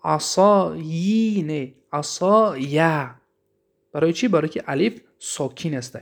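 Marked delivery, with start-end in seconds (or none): 0.0-3.1 عصایه drawn out very slowly, ending on a clear یه; slow demo pronunciation. Shown as a demonstration. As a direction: spell out عصایَ syllable by syllable.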